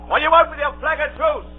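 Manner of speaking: high, carrying demand; shouted military question; challenging call. Acted. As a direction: call across to the enemy. Captain calls to the enemy.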